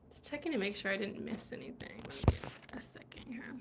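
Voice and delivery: very soft, half-whispered aside; hushed, barely voiced self-talk; muttering to self. Real, not acted. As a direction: murmur it under your breath while checking. Muttering to self while checking.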